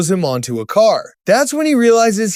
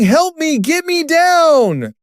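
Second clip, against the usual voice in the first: higher, drawn-out cry for help; understated narrated scream, pitched up and stretched; mild scream for help; 8 semitones higher, slower than usual. A narrator's reading. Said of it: "Huey starts screaming".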